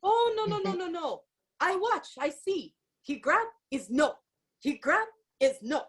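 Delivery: high, excited broken English of a Mexican bouncer; lightly accented bouncer, building to a loud boom. Retold or imitated. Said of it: "He's like".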